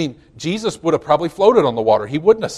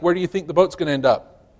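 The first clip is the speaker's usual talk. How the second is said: ordinary talk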